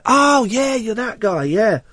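high, drawn-out "oh yeah"; sudden recognition. Acted. A listener recognising the crab meat caller, sudden recognition. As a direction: jump up high as it dawns on you.

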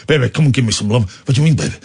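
low, gruff mock Vin Diesel drawling baby; lightly growled tough-guy lines ending in baby; low mock action hero voice; Vin Diesel impression. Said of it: "You know the guy I'm doing?".

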